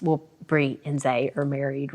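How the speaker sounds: ordinary delivery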